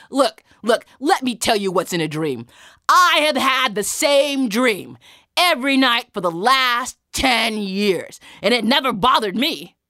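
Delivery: scornful, booming lecture; dismissive soldier. Acted. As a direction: set him straight forcefully with stretched words. Soldier boasts of his dream.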